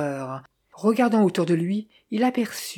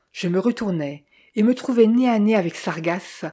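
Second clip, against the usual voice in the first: plain reading voice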